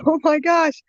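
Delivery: high startled gasp of alarm; shocked. Retold or imitated. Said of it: "I said".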